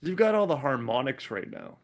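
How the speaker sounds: ordinary talk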